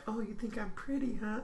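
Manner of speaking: high, soft and coy, like the barmaid teasing.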